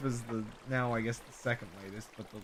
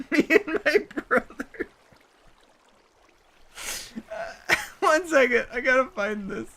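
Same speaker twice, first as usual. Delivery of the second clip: laughing; 13 semitones higher, 7 dB louder, slower than usual. Real, not acted. Cracking up over stupid video.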